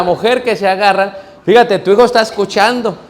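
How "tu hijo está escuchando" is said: ordinary delivery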